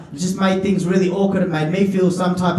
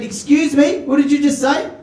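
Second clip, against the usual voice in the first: affronted; 6 semitones higher than usual. Acted. Acted confrontation with old lady.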